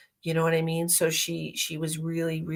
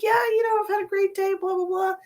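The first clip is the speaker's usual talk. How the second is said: cheery chat; 13 semitones higher than usual. Retold or imitated. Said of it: "I'm like".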